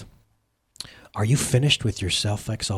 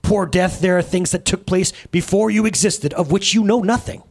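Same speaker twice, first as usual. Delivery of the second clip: belittling; 6 semitones higher than usual. A narrator's reading. Jesus belittles Death.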